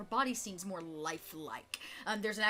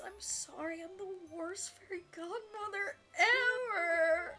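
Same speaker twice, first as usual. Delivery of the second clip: high, hammed-up tearful wail; wailing apology; 11 semitones higher than usual. Godmother apologises as she hands it over, mock-tearful wail. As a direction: apologise in a high whine, swelling and stretching the last word.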